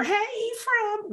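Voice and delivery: high, slow sing-song greeting; playful sing-song call. Retold or imitated. Greeting her friend by his nickname, high sing-song. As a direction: stretch the name out high and playful.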